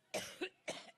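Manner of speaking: harsh repeated coughing; coughing fit. Real, not acted. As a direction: cough hard and can't stop. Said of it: "I get allergic".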